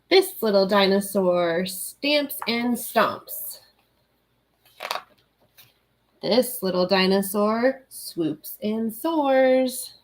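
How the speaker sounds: slow, lilting picture-book read; reading to children. Acted. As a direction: read it slowly like bedtime storytime. Reads a picture book aloud.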